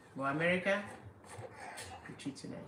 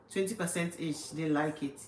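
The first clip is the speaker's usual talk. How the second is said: normal talking voice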